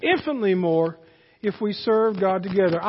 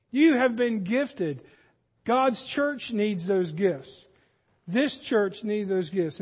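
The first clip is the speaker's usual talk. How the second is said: slow emphasis; slower than usual. Acted. Slow emphatic sermon point.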